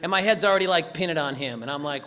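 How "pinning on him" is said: ordinary talk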